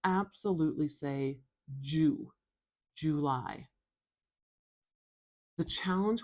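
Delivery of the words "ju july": "July" with a full "joo" first syllable; careful "Ju-", then "July" with no schwa; formal clear pronunciation. Shown as a demonstration. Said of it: "really formal and really clear".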